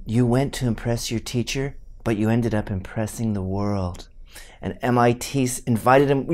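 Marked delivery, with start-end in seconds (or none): 0.1-4.1 soft, low, mock-tender tribute; effusive praise. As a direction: gush quietly with mock reverence. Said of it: "said effusively, beautifully things like".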